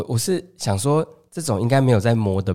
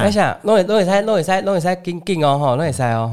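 higher, eager Taiwanese market-stall call; Taiwanese-speaking auntie; 6 semitones higher than usual. Retold or imitated. Market auntie calling to a passing shopper in Taiwanese, higher and eager. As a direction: hawk your meat in Taiwanese like a pushy auntie. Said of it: "他就说".

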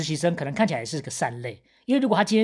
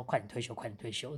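quiet muttered inner wish; 4 semitones lower, 12 dB quieter than usual. Retold or imitated. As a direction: mutter it under your breath. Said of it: "大家都心想说". (心里小声嘀咕)

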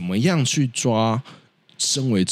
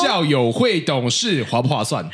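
livestream seller; 11 semitones higher, slower than usual. Pretend. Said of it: "现在直播带货是吧".

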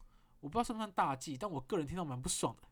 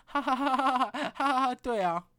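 loud, high fake laughter; ha-ha your way through an awkward reply; forced laugh; 8 semitones higher, 10 dB louder than usual. Polite fake laugh to the masseur, loud and high. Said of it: "我就是笑了一下".

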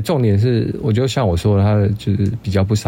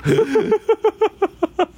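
high laugh that trails down; drawn-out squealing laugh; long high laugh falling away. Real, not acted. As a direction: laugh helplessly at the memory. Laughing retelling the story.

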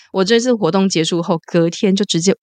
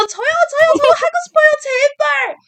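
desperate pleading call; much faster than usual. Retold or imitated. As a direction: plead harder to be picked. Fan begging idol to play.

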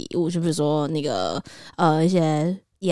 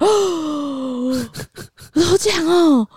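excited; 10 semitones higher than usual. Retold or imitated. Realizing she won first prize.

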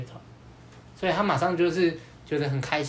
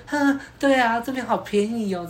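delighted surprise; 8 semitones higher than usual. Retold or imitated. Girl voiced, surprised it's cheap.

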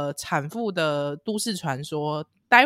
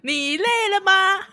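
ad slogan voice; 5 dB louder than usual. Pretend. Quoting an ad slogan.

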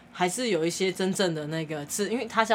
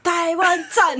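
higher, drawn-out mock cheer; 9 semitones higher, 4 dB louder, much slower than usual. Locals cheering at Taiwanese aunties, slow mock chant. Crowd shouting praise.

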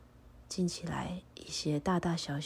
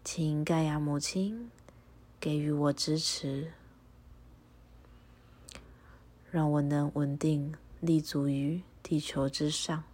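prayer recitation. Acted. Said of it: "请和我一起复诵一下祈祷文".

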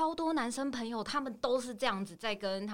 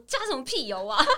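mock rude retort; 4 semitones higher, 4 dB louder than usual. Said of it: "你也不能回他说".

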